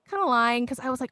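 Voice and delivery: normal talking voice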